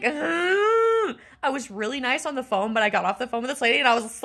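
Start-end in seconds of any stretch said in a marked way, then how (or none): 0.0-1.2 high, loud frustrated groan; drawn-out high groan of frustration; exasperated groan. Retold or imitated. Frustrated reaction to the clerk.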